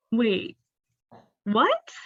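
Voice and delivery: halting, rising double-take; pause, then pitch up on a baffled question; stunned disbelief. Retold or imitated. Retold moment of shocked disbelief.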